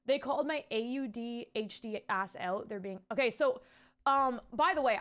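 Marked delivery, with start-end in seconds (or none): none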